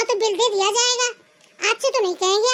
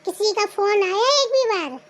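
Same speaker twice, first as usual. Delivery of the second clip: ordinary talk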